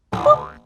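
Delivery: high bright button boop; high electronic boop. Acted. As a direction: pop out a high beep. Button press sound.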